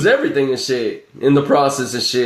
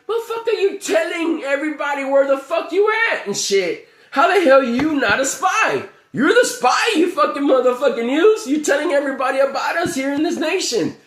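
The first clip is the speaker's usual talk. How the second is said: imitated yelling at the news; 10 semitones higher than usual. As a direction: yell it up high at the TV like you're fed up. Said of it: "I'm all like".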